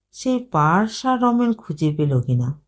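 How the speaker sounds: ordinary delivery, nothing marked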